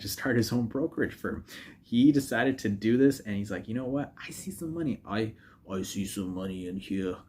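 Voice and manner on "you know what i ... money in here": mock Thor, a half-hearted try at the accent. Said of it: "I can't do the accent".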